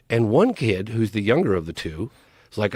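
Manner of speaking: usual speaking voice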